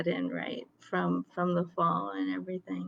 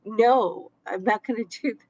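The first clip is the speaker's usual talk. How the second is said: emphatic mock refusal, louder on no; retold exclaiming; 3 semitones higher, 5 dB louder than usual. Grumpy customer turns the manager down, retold exclaiming. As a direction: re-enact snapping a firm no. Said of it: "that's how loud I'm exclaiming".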